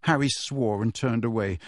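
usual speaking voice

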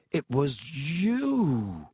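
very slow, dawning accusation; long, lingering stress on the last word; said slowly. A narrator's reading. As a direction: draw it out as suspicion dawns. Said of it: "he said slowly".

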